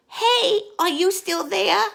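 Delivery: put-on high little kid voice; child's voice. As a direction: call out like a curious small child. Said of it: "the voice says again".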